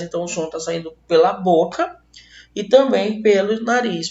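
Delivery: ordinary talk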